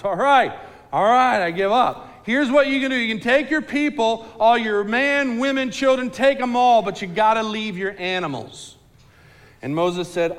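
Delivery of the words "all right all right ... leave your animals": higher, louder, frustrated surrender; Pharaoh giving in. Acted. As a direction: Pharaoh conceding to Moses, loud and frustrated. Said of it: "He says".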